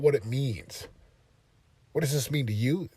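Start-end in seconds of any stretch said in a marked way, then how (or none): none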